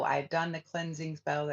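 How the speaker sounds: usual speaking voice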